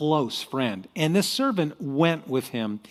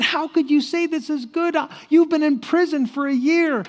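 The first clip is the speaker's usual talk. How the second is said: high, exasperated disbelief; incredulous king; 12 semitones higher, 3 dB louder than usual. Acted. King to servant jailed for a year, incredulous. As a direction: protest it as if he makes no sense.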